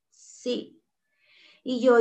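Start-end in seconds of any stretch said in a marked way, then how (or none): 0.1-0.8 a barely voiced, teary "Sí"; choked whisper. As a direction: whisper "Sí" holding back tears. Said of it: "está él con sus ojos llorosos". (un "sí" susurrado, con los ojos llorosos)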